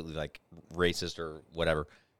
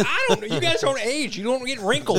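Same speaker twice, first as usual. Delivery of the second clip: high, loud retold protest; mock exasperation; 10 dB louder than usual. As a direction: plead loudly in comic exasperation. Acted exasperated reply about age.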